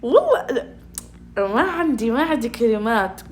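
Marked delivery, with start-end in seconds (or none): 0.0-0.7 high, louder words through laughter; laughing helplessly. Real, not acted. (كلام وسط ضحك محرج)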